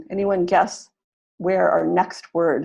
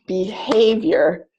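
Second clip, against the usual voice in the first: "Behavior" slowly, stress on the second syllable; stress pattern demo; much slower than usual. Shown as a demonstration. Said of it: "now I know that's the pattern".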